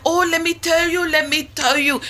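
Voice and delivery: high, loud imitation of a Jamaican caller; bad Jamaican accent. Imitating the Jamaican caller to the hosts, bad Jamaican accent. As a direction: mimic the sweet island lady in a hammed-up Jamaican lilt. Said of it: "that's a bad Jamaican accent".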